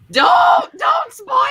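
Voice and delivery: sharp loud no, half laughing; playful shout. Real, not acted. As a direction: yell high and loud to stop a spoiler. Friend about to spoil book.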